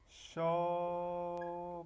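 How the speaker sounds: "shopping" with a long held sho, pause, then ping; drawn out in syllables. Shown as a demonstration. Teacher shows how shop becomes gerund, drawn out. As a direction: split "shopping" slowly into two level syllables. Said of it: "Shop quedaría así".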